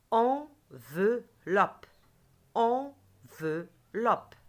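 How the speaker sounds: "Enveloppe" slow and loud, split en, ve, loppe; "Enveloppe" broken into slow, loud syllables. Shown as a demonstration. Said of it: "J'écoute et je dis la case".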